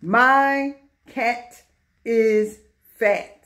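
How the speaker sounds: very slow, each word set apart; word-by-word reading. Shown as a demonstration. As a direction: read it slowly for young learners. Teacher reads example word by word.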